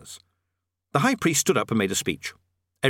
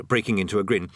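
plain reading voice

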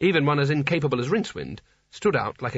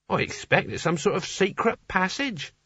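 higher, earnest adventurer's voice; curious Nigel; 6 semitones higher than usual. Acted. Nigel guesses at a secret passage, in a keen novice's voice. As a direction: play a keen novice making a discovery.

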